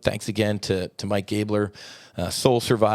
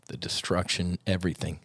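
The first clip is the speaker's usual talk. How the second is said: usual speaking voice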